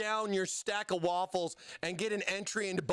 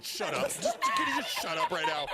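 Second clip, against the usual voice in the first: mock exasperated snap. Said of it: "sitting there going".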